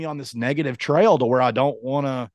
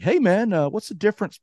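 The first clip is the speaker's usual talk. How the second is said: higher-pitched imitation of a friend; Colin; 9 semitones higher than usual. Retelling a friend's shopping text, pitched-up mimicking voice. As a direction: read his text in a mock innocent voice. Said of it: "starts texting me like".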